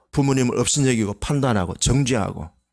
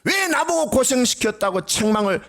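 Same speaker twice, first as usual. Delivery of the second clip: ordinary talk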